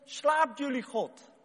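fast, high mocking goad; mocking taunt. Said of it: "En toen zei Elia".

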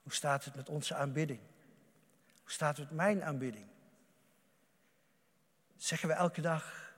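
usual speaking voice

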